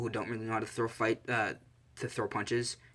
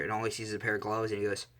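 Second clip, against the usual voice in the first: usual speaking voice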